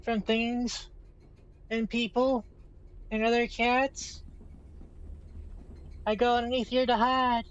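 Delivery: high shy cat voice; lightly voiced timid cat; voicing the cat. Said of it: "She's like".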